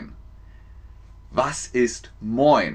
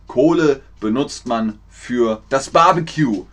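ordinary delivery

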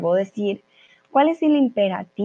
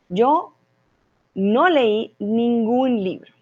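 slow, carefully spaced teacher demo; slow clear example sentence; slow model sentence; 3 semitones higher, slower than usual. Shown as a demonstration. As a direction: lay out each word slowly for learners. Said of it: "Ahí es la única forma en la que podemos usar".

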